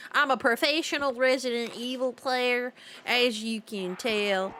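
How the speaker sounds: ordinary delivery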